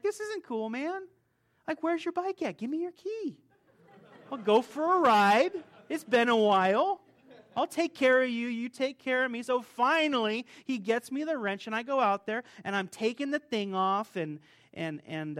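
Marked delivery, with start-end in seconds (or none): 0.0-9.4 exasperated sass. Retold or imitated. Frustrated outburst he imagined saying.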